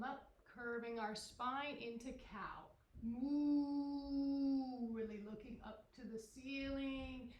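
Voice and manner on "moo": mooing like a cow; cheerful mock moo. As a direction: give a playful cow moo. Cow pose, animal sound.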